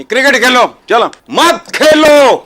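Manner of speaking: indignant shout. Real, not acted. TV pundit berating cricket fans and board, indignant shout. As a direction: slam the door on it, furious.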